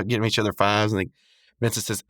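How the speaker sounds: ordinary delivery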